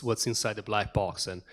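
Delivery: ordinary talk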